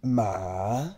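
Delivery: "Ma" descending low, then rising; dipping Mandarin tone on "Ma"; third tone. Shown as a demonstration. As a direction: draw "Ma" down before lifting it. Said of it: "you descend before rising".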